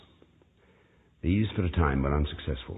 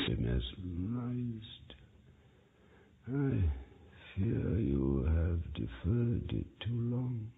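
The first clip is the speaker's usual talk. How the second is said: very soft, very slow grim pronouncement; grave hush; 3 semitones higher, 9 dB quieter, much slower than usual. A narrator's reading. Doctor telling the mesmerist it is too late, hushed and slow. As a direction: let it fall like a doctor's death sentence. Grim verdict over dying patient.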